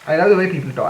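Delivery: ordinary talk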